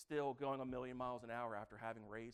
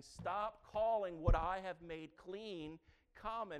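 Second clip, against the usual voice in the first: slow, firm divine rebuke; voice of God; 5 semitones higher, 7 dB louder, slower than usual. Retold or imitated. God corrects Peter, slow and firm. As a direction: spell it out patiently but firmly. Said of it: "God is saying".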